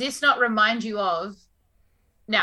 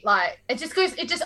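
ordinary delivery

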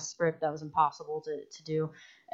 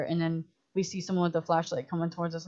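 ordinary delivery

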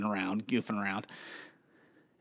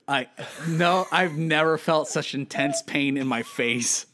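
usual speaking voice

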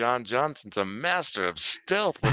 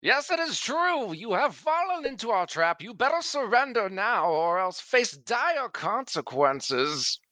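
theatrical goblin; 9 semitones higher than usual. Acted. Goblin actor luring the enemy with a bold lie, high and theatrical. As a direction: declaim it like a stage villain. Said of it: "use your actor ability".

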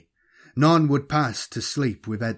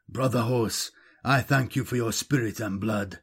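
usual speaking voice

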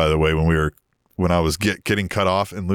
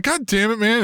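mock annoyed; 13 semitones higher than usual. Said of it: "he was pissed".

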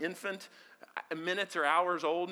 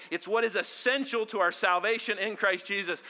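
ordinary talk, nothing marked

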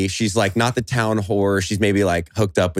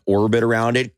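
ordinary talk

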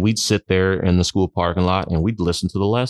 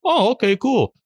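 high, bright-eyed "oh okay"; mock surprise; 14 semitones higher than usual. Retelling his reaction to a friend, high mock surprise. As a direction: play it like news that impresses you. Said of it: "I go".

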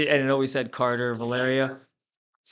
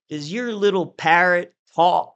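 slow, loud, higher Southern drawl; deep Southern accent; 5 semitones higher, 6 dB louder, slower than usual. Retold or imitated. Chairman mocking a silent new delegate, deep Southern accent. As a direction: imitate a Southern chairman's drawn-out jibe. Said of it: "Deep Southern accent".

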